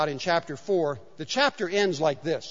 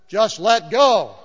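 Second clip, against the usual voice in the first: calm God; 2 semitones higher, 7 dB louder, much slower than usual. Retold or imitated. God answers calmly.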